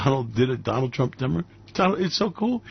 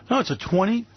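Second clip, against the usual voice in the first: normal talking voice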